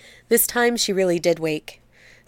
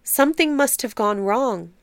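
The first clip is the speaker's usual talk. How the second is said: usual speaking voice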